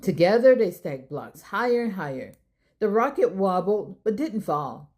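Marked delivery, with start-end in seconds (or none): none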